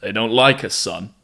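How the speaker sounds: put-on New York accent. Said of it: "I did the New York accent again".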